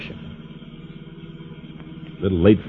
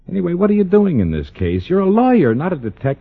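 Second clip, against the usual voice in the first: exasperated scolding; 8 semitones higher than usual. Acted. Husband scolding wife.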